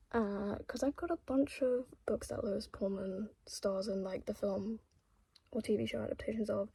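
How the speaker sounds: soft unvoiced whisper; breathy night-time whisper. Real, not acted. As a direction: keep it hushed, late at night. Late-night aside, whispering.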